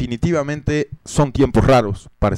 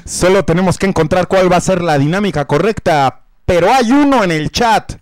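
ad announcer; 7 semitones higher, 5 dB louder than usual. Acted. Hyped merch commercial read.

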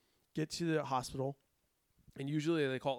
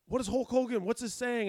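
higher, louder prompting; coaxing questions; 7 semitones higher, 5 dB louder than usual. Retold or imitated. EMT trainee distracting patient with wrestling, coaxing questions. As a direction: quiz him like an excited kid.

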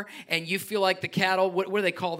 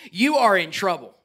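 ordinary talk, nothing marked

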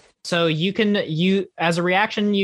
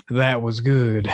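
lower, gruff NPC voice; Verdonis voice; 5 semitones lower, slower than usual. Acted. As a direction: deliver it as the watching mentor. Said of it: "you hear Verdonis's voice go".

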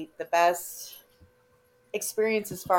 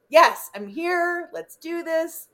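excited; 8 semitones higher, 4 dB louder, much slower than usual. Retold or imitated. Eager acted quote.

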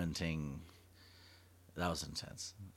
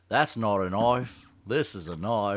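slow, louder Australian quote; Crocodile Dundee; 2 semitones higher, 8 dB louder, slower than usual. Retold or imitated. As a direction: growl it like the film's Aussie hero. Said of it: "Crocodile Dundee".

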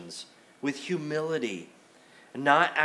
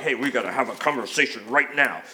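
wrong tone; 5 semitones lower, 3 dB louder than usual. Acted. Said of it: "you gotta use the right tone".

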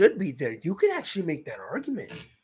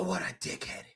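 whispered insult; 3 semitones lower, 10 dB quieter than usual. Real, not acted. Disgusted aside about JBL.

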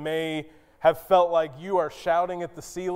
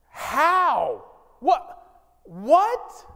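climbing higher and louder with disbelief; each What pushed up in shock; incredulous; 9 semitones higher, 4 dB louder, much slower than usual. Acted. Zechariah stunned by news.

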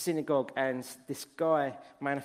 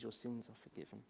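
gentle forgiveness; 4 semitones lower, 14 dB quieter than usual. Retold or imitated. Jesus forgives the distraught woman.

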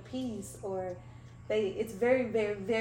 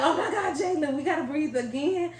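higher, amused exasperation; laughing mock groan; 7 semitones higher, 7 dB louder than usual. Friend mocks having to breathe again, laughing. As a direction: roll your eyes through a laugh.

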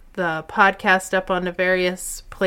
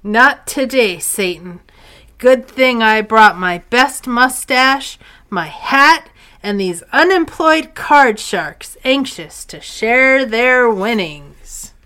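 hammy hero; 3 semitones higher, 7 dB louder than usual. Acted. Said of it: "a dramatic reenactment".

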